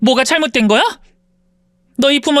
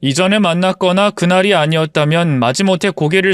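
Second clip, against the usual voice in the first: lower, subdued storyteller voice; story narration; 5 semitones lower than usual. A narrator's reading. Former maid tells listeners her story, subdued narration. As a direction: recount it calmly to the listener.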